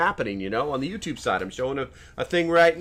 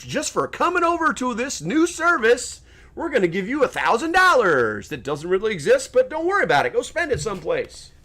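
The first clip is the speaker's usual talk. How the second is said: high, louder salesman voice for free money; cheesy government pitch; 10 semitones higher, 5 dB louder than usual. Retold or imitated. Mocking the government's free money pitch, high and loud. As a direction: sell it like a too-good-to-be-true offer.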